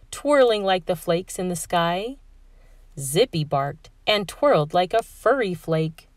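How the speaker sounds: usual speaking voice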